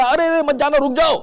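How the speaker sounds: high, loud call to stop; urgent call. Pretend. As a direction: shout a quick warning to a stranger. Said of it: "آپ نے فوراً اس کو پکارا". (اونچی آواز میں روکنے کی پکار)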